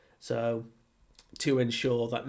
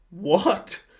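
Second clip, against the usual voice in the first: loud, high shocked what; bigger shocked what; 8 semitones higher, 9 dB louder than usual. Retold or imitated. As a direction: yell it in total disbelief. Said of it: "reacting more and more shocked".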